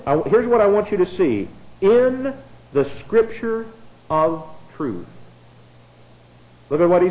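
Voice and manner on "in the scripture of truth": "In", "the scripture", "of truth" set apart by pauses, "In" held high; emphatic, spaced-out reading. Real, not acted. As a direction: read "In ... the scripture ... of truth" piece by piece, falling on "truth". Verse highlighted word by word for congregation.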